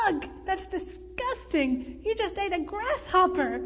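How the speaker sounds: high, appalled exclamation; disgusted outburst. A narrator's reading. Brandon to a man who ate a grasshopper, disgusted. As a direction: recoil in disgust, pitch jumping up.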